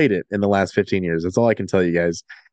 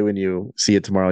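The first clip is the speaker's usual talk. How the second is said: usual speaking voice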